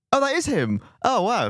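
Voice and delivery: high-pitched surprised gasp. Retold or imitated. Retelling own surprise.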